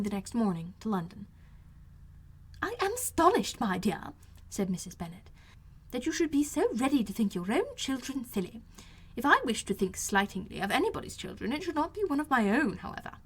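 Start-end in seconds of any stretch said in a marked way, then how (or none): 2.6-4.1 high, agitated mother voice; high fretful matron protesting; fussy Mrs Bennet. A narrator's reading. 5.9-13.2 high, agitated mother voice; high fretful matron protesting; fussy Mrs Bennet. A narrator's reading.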